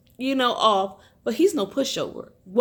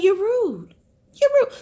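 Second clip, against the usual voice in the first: much higher and louder, indignant; outraged scold; 10 semitones higher, 5 dB louder than usual. Real, not acted. Vlogger scolds the rude wife directly, outraged and loud. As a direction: snap it right at her.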